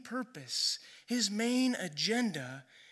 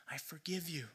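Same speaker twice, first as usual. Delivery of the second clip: whisper; 3 semitones lower, 12 dB quieter than usual. Acted. Jesus forgiving the paralyzed man.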